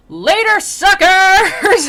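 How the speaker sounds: high, very loud gleeful taunt; mock taunting shout. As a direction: holler it like a cartoon escape artist. Acted escapee taunting as he leaps.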